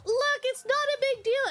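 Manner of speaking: mock coy reassurance. Streamer imagining hurt girl telling Sakura, mock coy. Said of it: "And then it's like".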